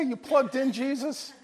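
normal talking voice

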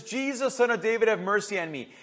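first, milder cry to Jesus; restrained retold cry. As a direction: call out for mercy, held back. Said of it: "He cries out and says".

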